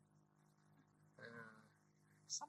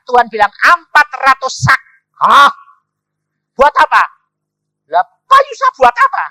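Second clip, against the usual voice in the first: loud, high retold challenge; full-force preaching; 10 semitones higher, 16 dB louder than usual. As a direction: hurl the questions out to the congregation. Menirukan tawar-menawar dengan suara penuh. (berkhotbah lantang, menirukan tantangan dengan nada tinggi)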